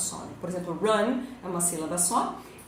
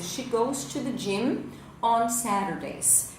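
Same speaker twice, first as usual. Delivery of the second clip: slow, careful English example; slow model sentence; 2 semitones higher, slower than usual. Shown as a demonstration. Teacher reading an example sentence to students, slow and careful. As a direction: set out each word slowly for learners.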